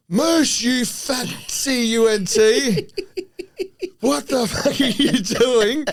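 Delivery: imitating a teammate's furious bellow across the field; high, loud, drawn-out mock yell; Coops yelling out. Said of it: "from Coop scowling out".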